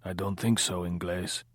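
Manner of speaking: a mild, lightly voiced low drunken grumble; just a hint of a wine-soaked grumble; drunk Pablo. A narrator's reading. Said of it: "his voice a low, grumbling sound, wine-soaked, Pablo drunk".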